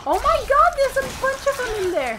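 high, loud panicked cry; panicking gamer. Real, not acted. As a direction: scream at the horde in fright. Horde behind the door.

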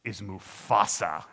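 slow, icy correction with a heavy pause; imitating a stern, chilly guide; cold. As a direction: lean in coldly, word by word. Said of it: "he looks at me so coldly".

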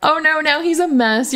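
ordinary talk, nothing marked

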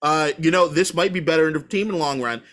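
a hint of a patronising critic; mock know-it-all. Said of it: "people can tell me, like".

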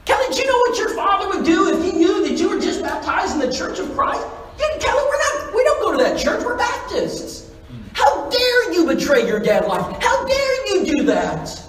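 high, loud, outraged scolding; yelling mother. Retold or imitated. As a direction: act out a mom shrieking her indignation. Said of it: "She's yelling and sc".

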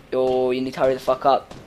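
ordinary talk, nothing marked

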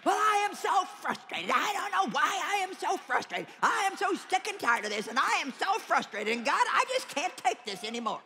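a hint of an exasperated churchgoer venting; frustrated believer. Preacher voicing a worn-out believer at the conference, mock frustrated whine.

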